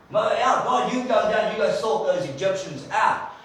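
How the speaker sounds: high, loud eager cheering; mock eager Moses cheering; excited cheer. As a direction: egg God on gleefully.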